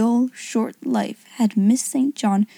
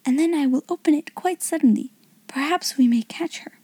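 whispering; 5 semitones higher than usual. Acted. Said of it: "she whispered".

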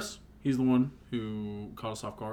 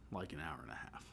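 whispered aside; 11 semitones lower, 20 dB quieter than usual. Real, not acted. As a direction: drop to a whisper. Exaggerated aside about long call.

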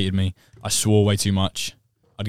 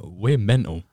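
normal talking voice

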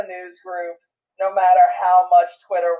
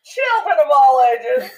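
ringmaster; 5 semitones higher than usual. Pretend. Joins the ringmaster opening.

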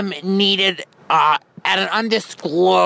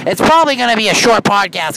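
raised voice over cabin noise; louder over the noise; 4 semitones higher, 6 dB louder, faster than usual. Shown as a demonstration. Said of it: "Now they're turning the air up".

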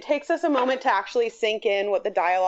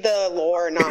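usual speaking voice

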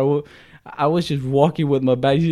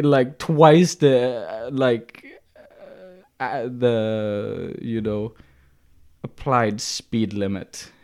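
ordinary delivery

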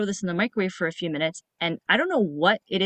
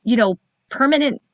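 shocked; 6 semitones higher, 4 dB louder than usual. Retold or imitated. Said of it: "I was like".

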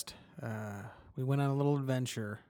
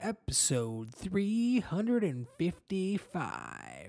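hyped crowd warm up; 6 semitones higher, much slower than usual. Acted. Spooky Halloween intro.

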